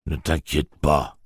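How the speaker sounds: slow, lightly voiced little gruff engine; a hint of a small gruff train reassuring. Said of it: "dit une petite voix bourrue".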